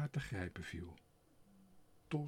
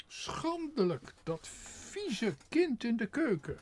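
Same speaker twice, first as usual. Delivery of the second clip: high, loud scandalised scolding; much higher and louder, dripping with disgust; disgusted lady; 12 semitones higher, 6 dB louder than usual. A narrator's reading. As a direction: Schandelijk drawn out slowly and high, then scolding.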